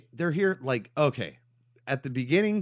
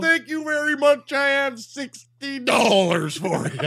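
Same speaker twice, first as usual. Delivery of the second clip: Matt Foley; 13 semitones higher, 6 dB louder than usual. Acted. Hitchhiker thanking the young people, hammed-up high bellow. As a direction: overplay the booming character voice. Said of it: "might as well be Matt Foley".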